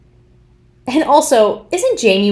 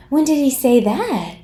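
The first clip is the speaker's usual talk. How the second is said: higher mock-surprised question; feigned ignorance; 5 semitones higher than usual. Commentator imitating Meghan hearing Harry's praise, feigned surprise. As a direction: act as if you never heard it. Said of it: "she's pretending as if she'd never heard this before".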